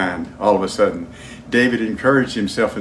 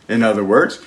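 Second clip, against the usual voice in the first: ordinary talk, nothing marked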